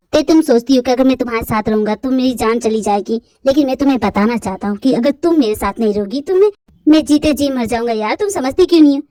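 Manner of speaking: put-on young man's voice. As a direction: pour out your heart.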